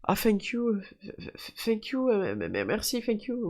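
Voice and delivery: put-on high, stumbling thank you with a French accent; flustered fan. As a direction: stammer thanks like a nervous girl. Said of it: "elle me dit".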